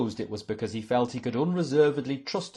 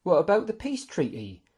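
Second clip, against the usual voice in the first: higher, anxious question; dismayed; 9 semitones higher, 4 dB louder than usual. A narrator's reading. Guest asks his lordship about the peace treaty, dismayed. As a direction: ask in mild dismay. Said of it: "asked Algoldion in dismay".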